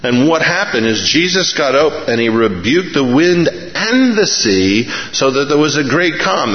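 normal talking voice

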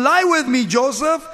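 loud, high-pitched plea; urgent seductress. Acted. Wife catching Joseph by his garment, urgent loud plea. Reading wife's plea aloud, acted.

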